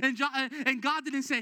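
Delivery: loud, high, driving sermon voice; preaching at full force. Acted. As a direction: preach it hard and push the congregation.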